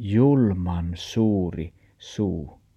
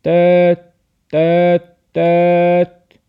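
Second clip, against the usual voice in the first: loud, high imitated honking; truck horn honks; 10 semitones higher, 11 dB louder, slower than usual. A narrator's reading. Truck roars towards Max, blaring horn imitation. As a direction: blare three long toots like a truck horn. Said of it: "lastipiili pauhasi sitä kohin".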